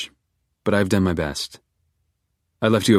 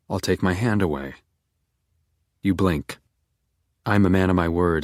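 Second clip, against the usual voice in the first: plain reading voice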